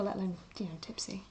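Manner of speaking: hushed aside whisper. Real, not acted. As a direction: drop to a secret whisper.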